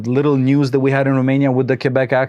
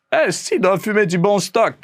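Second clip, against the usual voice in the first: higher, teasing Quebec French voice; imitating Quebec guy; 7 semitones higher than usual. Retelling the tech's teasing reply in a higher Quebec accent. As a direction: mimic the guitar tech's Quebec slang, higher. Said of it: "he goes like".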